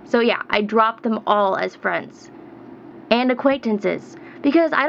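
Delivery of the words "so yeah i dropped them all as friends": normal talking voice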